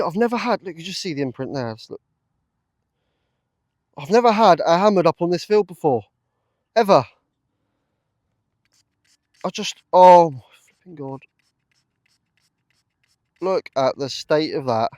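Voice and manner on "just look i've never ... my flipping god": loud, raised overjoyed exclamation; elated. Real, not acted. Detectorist to viewers about his first hammered coin, loud and elated. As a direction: blurt out your disbelief.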